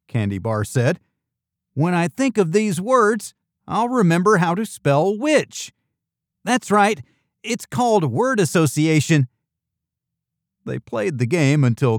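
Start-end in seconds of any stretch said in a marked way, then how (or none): 1.7-9.3 put-on high, lively kid character; raised youthful character voice; eager child. A narrator's reading. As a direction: explain eagerly, pitched well up.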